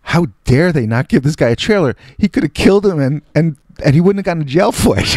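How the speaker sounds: high-pitched incredulous outrage; mock indignation. Naive kid defending star's trailer demand, indignant. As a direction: protest on the star's behalf, absurdly earnest. Acts younger self's indignant awe.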